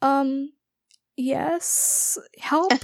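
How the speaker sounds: small, hesitant, rising; sheepish plea. Retold or imitated. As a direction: meekly ask for help with pauses.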